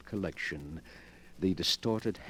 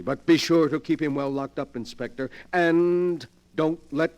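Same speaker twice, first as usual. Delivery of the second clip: louder, higher, pressing the warning; urgent warning; 7 semitones higher, 8 dB louder than usual. Acted. Morlock warning the inspector to keep Kane locked up, urgent and loud. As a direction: drive home the danger of his hands.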